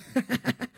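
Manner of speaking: short high crazed giggle; manic giggle. A narrator's reading. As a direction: giggle like a possessed man. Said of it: "бесновато захихикал он".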